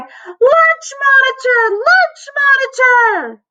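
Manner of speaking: very high, slow alarm call; loud cry. A narrator's reading. Boy warning the lunchroom, crying out. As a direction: yell a drawn-out warning at the top of the range. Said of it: "cupped his hands around his mouth and cried".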